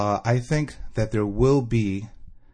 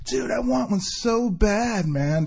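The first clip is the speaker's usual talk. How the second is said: high, drawn-out sarcastic gushing; mock excitement; 13 semitones higher, much slower than usual. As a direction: gush sarcastically in a stretched, high voice. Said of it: "I was looking at it being sarcastic".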